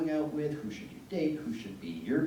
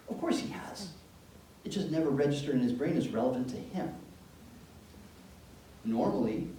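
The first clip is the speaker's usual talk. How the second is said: normal talking voice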